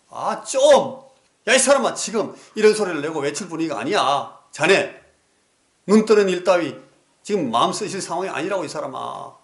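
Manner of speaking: loud, high-pitched telling-off, acted out; scolding rebuke. Disciples telling a blind beggar to stop shouting, loud scolding. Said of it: "많은 사람들이 그를 꾸짖습니다".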